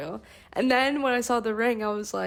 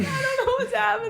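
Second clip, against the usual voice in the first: high, laughing disbelief; 12 semitones higher, 4 dB louder, faster than usual. Retold or imitated. Fiancée recalling the proposal, laughing high. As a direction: laugh it out in giddy confusion. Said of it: "Just hopping".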